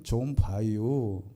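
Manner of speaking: very slow, soft Chungcheong drawl; slow Chungcheong dialect. Retold or imitated. Lecturer imitating a Chungcheong speaker, very slow and soft. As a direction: stretch it out lazily in Chungcheong style. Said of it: "충청도 사람은 뭐라 그러냐면".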